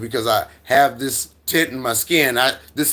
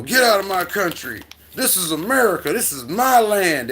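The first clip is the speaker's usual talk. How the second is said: higher, mock-indignant shout of a flag-waver; mock angry patriot; 8 semitones higher than usual. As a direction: ham up an outraged local telling him to leave. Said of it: "people say things like that".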